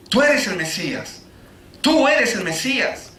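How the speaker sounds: ordinary delivery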